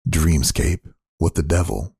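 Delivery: ordinary delivery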